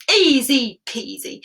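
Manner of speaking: slow boastful easy peasy; cocky. Acted. Eldest brother boasting to the king, slow and cocky. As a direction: toss it off like a show-off. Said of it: "said the eldest brother".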